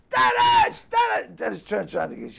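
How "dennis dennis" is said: high, loud mock holler; retold name shouting. Comedian calling his disguised friend at the concert, shouting. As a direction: yell his name to draw the crowd's eyes.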